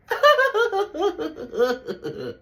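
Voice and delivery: real laughter at the twist; long high laugh. Real, not acted. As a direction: laugh it out, delighted.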